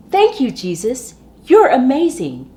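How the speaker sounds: high, loud and beaming; children's storyteller modelling glad thanks; joyful praise. Acted. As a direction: cheer it out gratefully. Quoted praise, reading to children.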